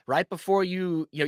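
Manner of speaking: ordinary talk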